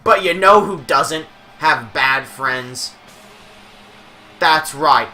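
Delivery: slow, drawn-out ring announcer build-up; wrestling announcer hype. Pretend. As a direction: stretch it out like introducing the champ. Hyping a wrestler's entrance.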